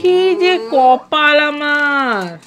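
slow, drawn-out mock lament. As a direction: moan about your luck. Lamenting bad luck.

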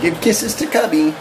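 quacky cartoon duck voice; Donald Duck voice. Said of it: "There's Papa's Donald Duck voice".

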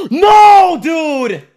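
sudden yell of disbelief; shocked shout. Real, not acted. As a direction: scream it out in shock. Gets killed, shouts in shock.

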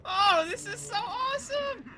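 high-pitched, loud gushing; thrilled. Real, not acted. Player thrilled at finding a bladderfish, gushing. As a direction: squeal it with delight.

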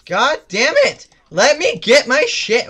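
high, loud, drawn-out exasperation; real gamer rage, stretched out; frustrated yelling. Real, not acted. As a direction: yell each word in exasperation. Frustrated at enemies in game.

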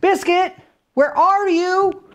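put-on high, slow little-girl call. Librarian voicing a girl searching for her puppy, high calling voice.

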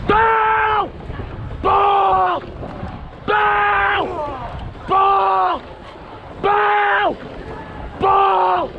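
rhythmic shouted calls. Real, not acted. Cox calling stroke rhythm.

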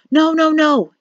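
man crying out in protest; cried out. A narrator's reading. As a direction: cry out to stop her. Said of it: "he cried".

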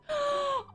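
high, soft drawn gasp; shocked gasp. Real, not acted. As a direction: suck in air sharply in shock. Reacts to in-game events.

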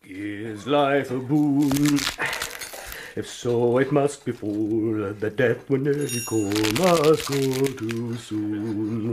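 slow, measured verse reading; poem recital. Acted. Student reciting a poem aloud over his work. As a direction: recite the lines slowly to himself.

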